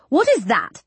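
sharp, suspicious question; sharp matron. A narrator's reading. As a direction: demand it like a strict matron. Matron pointing at necklace.

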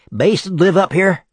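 put-on high young messenger voice; a boy calling up the stairs. A narrator's reading.

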